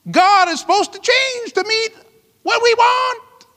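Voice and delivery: high-pitched, rising outrage; indignant disbelief. Real, not acted. Indignant at Christianity flipped around.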